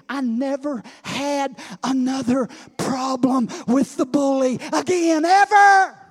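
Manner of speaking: builds from normal to a loud high shout on ever; building to a shout. Real, not acted. Preacher shouting to his congregation, building.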